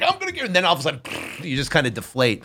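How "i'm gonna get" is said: way up high, bursting with hope; pumped like a balloon; puffed-up excitement. Retold or imitated. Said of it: "you're all, like, blown up".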